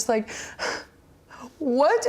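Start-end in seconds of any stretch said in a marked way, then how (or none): 0.3-0.8 short, soft gasp of disbelief; sharp intake of breath in mock shock. Retelling the news of her championship, shocked gasp. Reaction to sudden title news. 1.6-2.1 high-pitched, disbelieving "what?"; re-enacted shock after a gasp; stunned. Retelling news of her debut and championship, stunned. Reaction to sudden title news.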